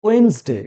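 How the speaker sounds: ওয়েডনেসডে shrunk to "wens-de", first d silent; corrected Wednesday. Shown as a demonstration. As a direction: say ওয়েডনেসডে in two syllables, "WENZ-day". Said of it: "কি হবে?". (ওয়েডনেসডে-র সঠিক উচ্চারণ, "ওয়েন্স-ডে")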